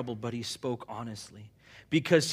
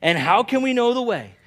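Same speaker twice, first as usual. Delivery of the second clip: Thomas pleading; 8 semitones higher, 11 dB louder than usual. Retold or imitated. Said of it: "he said".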